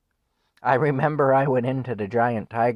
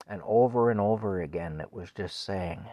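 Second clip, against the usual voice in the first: ordinary talk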